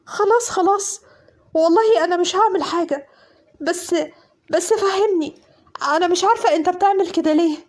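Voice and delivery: high, frantic begging; terrified pleading. Acted. Woman begging armed cousin to stop, terrified. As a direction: plead for her life, breathless.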